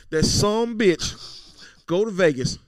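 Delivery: Southern accent. Shown as a demonstration. Host mocking O J on the podcast, slow Southern accent. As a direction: draw the Southern accent out like a movie line. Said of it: "the Eddie Murphy movie".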